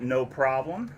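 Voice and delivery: ordinary talk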